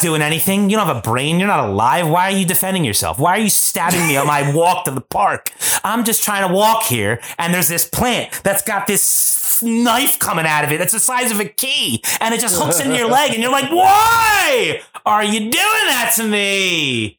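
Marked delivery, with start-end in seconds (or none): 5.8-17.2 building from a slow, higher complaint to a shouted, drawn-out cry; indignant rant. Pretend. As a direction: work yourself up until you yell at the plant. Ranting at a thorny plant.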